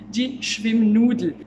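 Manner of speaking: teacher presenting a German word slowly and loudly; loud slow demo. Shown as a demonstration. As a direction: announce the new word slowly for learners. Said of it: "Je vais t'apprendre du vocabulaire".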